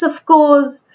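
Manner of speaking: ordinary delivery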